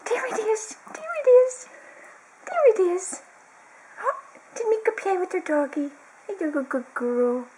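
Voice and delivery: high, sing-song pet voice. Real, not acted. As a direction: coo at the puppy, excited. High pet talk to the dog.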